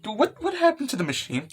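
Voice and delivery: high, stammering disbelief; bewildered. Acted. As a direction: act shocked, tripping over the question. Character finds the broken machine.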